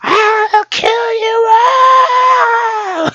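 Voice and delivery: high, slow crying shout; Mel Gibson. Pretend. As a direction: wail it like an unhinged star. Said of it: "Mel Gibson".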